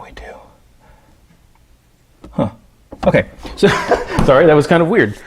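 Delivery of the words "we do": whispered discovery; soft secret aside. Acted. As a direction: whisper it to yourself. Whispered to self.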